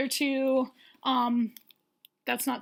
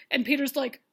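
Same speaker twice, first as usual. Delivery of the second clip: ordinary talk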